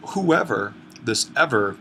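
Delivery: "Whoever" with "ever" leaned on, then "this ever" stressed again. Shown as a demonstration. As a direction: point out "ever" by pressing on it. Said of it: "gives us a sense that this is a general condition".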